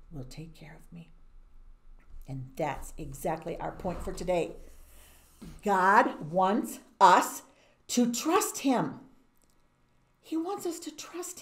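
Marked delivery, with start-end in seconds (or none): 0.1-1.1 trusting whisper. Acted. Daniel's quiet trust. 5.6-9.1 slow, loud, every word weighted; emphatic point. Real, not acted. Lesson's main point.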